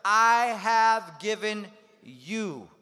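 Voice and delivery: slow, loud, each word drawn out; leading the congregation. Shown as a demonstration. Preacher leading the congregation in a verse, loud and slow. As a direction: lead a crowd reciting together. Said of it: "Say that together".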